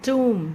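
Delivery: "tomb" said "toom"; long oo in "tomb", no b; silent b, oo vowel. Shown as a demonstration. Teacher repeating the correct form, "toom". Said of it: "इसका pronunciation कुछ".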